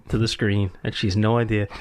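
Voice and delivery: normal talking voice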